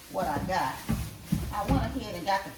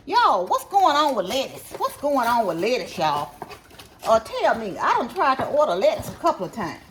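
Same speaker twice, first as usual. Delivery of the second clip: loud, high and animated griping; loud exasperated complaint; 5 semitones higher, 8 dB louder than usual. Real, not acted. Shopper asks viewers about lettuce orders, loudly exasperated. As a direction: demand answers, voice raised and pitched up.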